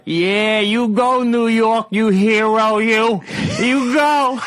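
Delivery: loud, high Boston heckler yell; Boston accent. Retold or imitated. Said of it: "in perfect Boston accent right behind me, you hear some guy yell out".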